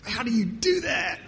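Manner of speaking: a half-joking cry of disbelief at her; amazed. Retold or imitated.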